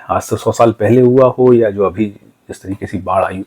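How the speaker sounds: normal talking voice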